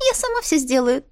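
high quick spirit voice; a bubbly little spirit; sprite voice. A narrator's reading. (высокий голосок духа)

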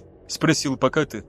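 ordinary delivery, nothing marked

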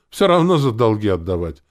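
cheerful. A narrator's reading. Gambler dismissing money owed for debts, cheerful. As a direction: shrug it off loudly and merrily. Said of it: "махнул рукой и сказал весело".

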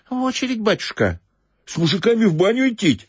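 a hint of a flustered old woman. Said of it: "сказала старушка".

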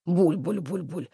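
low, slow, soft bubbling laugh; gurgling guffaw. A narrator's reading. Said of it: "зареготали подводные братья".

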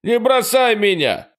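very high, loud begging wail; desperate cry. A narrator's reading. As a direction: beg not to be left behind. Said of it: "простонал за его спиной Фрэнк".

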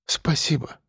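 weak little voice; feeble babble. A narrator's reading. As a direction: barely get it out, drained of strength. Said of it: "пролепетала Олеся".

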